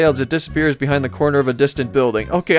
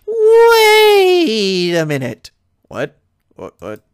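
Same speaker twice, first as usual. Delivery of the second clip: high, loud, stretched-out shock; Pinkie Pie; 7 semitones higher, 6 dB louder, much slower than usual. Acted. Pinkie realizing a newcomer is staying, astonished. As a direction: draw out the first word high, then slide down.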